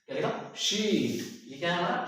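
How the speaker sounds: usual speaking voice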